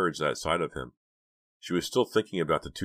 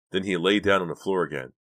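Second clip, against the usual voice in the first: ordinary delivery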